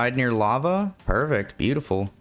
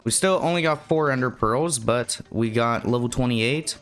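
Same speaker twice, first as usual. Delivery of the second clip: ordinary talk, nothing marked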